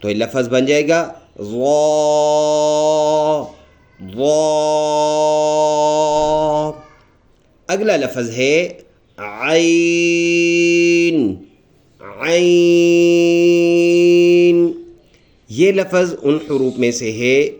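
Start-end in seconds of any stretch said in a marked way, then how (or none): 1.4-6.7 the letter said full-mouthed and drawn out; heavy Zoa. Shown as a demonstration. Teacher demonstrates the dotted letter: heavy, long vowel. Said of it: "منہ بھر کے پڑھیں گے". 9.2-14.7 عین pressed from the throat, drawn out; throaty Ain. Shown as a demonstration. Teacher shows children a throat letter: long throaty عین. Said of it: "جو گلے سے ادا ہوتے ہیں".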